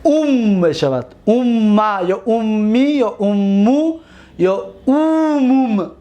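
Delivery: slow, deliberate listing; slow recitation. Shown as a demonstration. Teacher to class, slowly listing the imperative forms. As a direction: count off each variant slowly.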